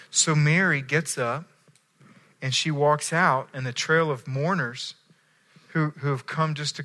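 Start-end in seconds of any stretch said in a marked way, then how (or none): none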